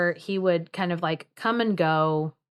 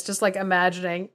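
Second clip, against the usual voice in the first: usual speaking voice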